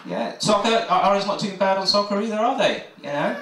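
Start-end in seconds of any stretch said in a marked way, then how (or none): none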